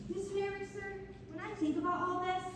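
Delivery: put-on a shy girl softly asking to speak; timid interruption. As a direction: politely break in on the narrator, small and hesitant. Girl addresses the narrator again.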